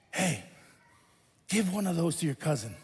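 a hint of a calm mom telling her kid; mom's voice.

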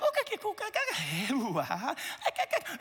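squeaky, high gurgles and coos; playful mock baby noises; baby babble. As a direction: imitate a baby's happy babbling. Mimics his baby babbling.

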